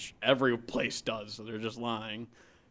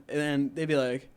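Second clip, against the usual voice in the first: normal talking voice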